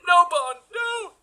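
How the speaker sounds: high-pitched, strained plea for rescue; mock terror. Victim begs Bond as villain drills, mock terror. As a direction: squeal for help in play-acted panic.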